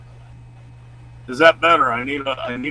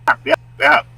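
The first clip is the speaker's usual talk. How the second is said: high, loud yapping bark; dog barking; 10 dB louder than usual. Retold or imitated. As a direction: yap like a small dog.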